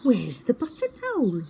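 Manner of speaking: high, puzzled and innocent; confused little owl voice. A narrator's reading. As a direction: stammer it in wonder. Twit asks innocently.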